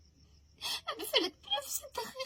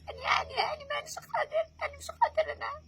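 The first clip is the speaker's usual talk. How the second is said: loud, high sobbing cry; crying shout; 6 semitones higher, 4 dB louder than usual. Real, not acted. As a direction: wail it out, breaking down. Sleepless grieving daughter cries out.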